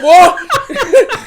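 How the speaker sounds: loud, high-pitched yell; shouting back. Retold or imitated. Man answering a stranger on the platform, shouting back. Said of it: "she shouting back".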